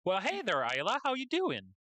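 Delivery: a light, hammed-up Southern greeting rising high at the end; southern accent. GM voicing Lyra greeting Isla in a mock Southern accent. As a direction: try a friendly Southern hello, pitch climbing on the question. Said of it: "It's the best I can do".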